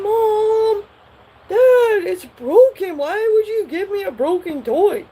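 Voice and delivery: high, loud whine to mom and dad; whining child. Retold or imitated. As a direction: complain like a wronged little kid. Child complains to parents.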